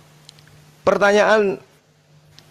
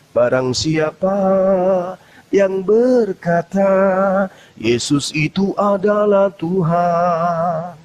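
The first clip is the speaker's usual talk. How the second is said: solemn scripture reading; 4 semitones lower, much slower than usual. Acted. Solemnly reciting a Bible verse.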